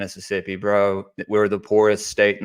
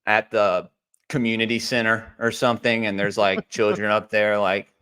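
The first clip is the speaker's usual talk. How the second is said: ordinary talk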